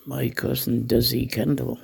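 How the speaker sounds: ordinary talk, nothing marked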